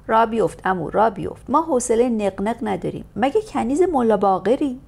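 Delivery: loud, impatient orders to the driver; sharp scolding. Acted. Lady scolds the grumbling coachman to get going, loud and sharp. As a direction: tell him off, fed up.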